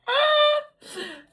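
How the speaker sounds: a high squealing giggle. Real, not acted. Reader giggling at her own slip of the tongue, high squeal. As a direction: let a giggle burst out.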